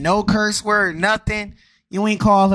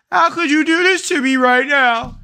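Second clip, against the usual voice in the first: higher, louder whiny mock of the hurt dad; 7 semitones higher, 4 dB louder than usual. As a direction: ham up the betrayed dad's plea. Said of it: "Fake demons".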